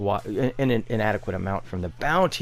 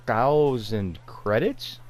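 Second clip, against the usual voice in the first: ordinary talk, nothing marked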